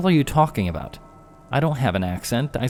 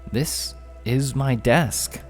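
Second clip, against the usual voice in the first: gasping in wonder; 6 semitones higher, 3 dB quieter, much slower than usual. Acted. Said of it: "Jeff gasped in wonder".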